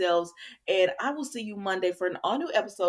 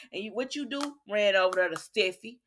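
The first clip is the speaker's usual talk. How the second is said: ordinary talk, nothing marked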